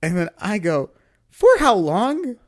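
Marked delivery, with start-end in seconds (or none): 1.3-2.3 loud, high, drawn-out question; acting out an appalled demand; retold incredulous demand. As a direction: stretch it out in raised disbelief. Acts out shocked demand.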